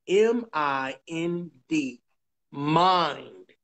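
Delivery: slow, low spelling-out, then a drawn-out MIND; real slow. Shown as a demonstration. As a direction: spell M I N D slowly, letter by letter. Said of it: "say this again real slow".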